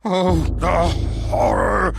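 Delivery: loud, high cry of horror; anguished cry. Acted. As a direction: wail it out. Madman cries out.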